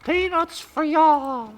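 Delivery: very high, loud, slow squawk; mock flight attendant answering the game's collision; clucking flight attendant. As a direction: cluck it out like a chicken. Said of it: "you know what I have to say to that".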